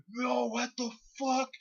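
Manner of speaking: very high-pitched outraged cry; mock flipping out. Scotty hit in the face, mock outraged freak-out. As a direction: imitate a freak-out, amused.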